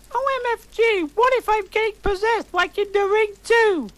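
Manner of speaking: high, loud panicked cry. A narrator's reading. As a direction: shriek it in panic. Narrator panicking about possession.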